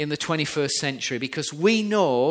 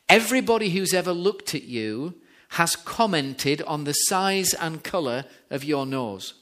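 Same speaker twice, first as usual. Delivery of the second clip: usual speaking voice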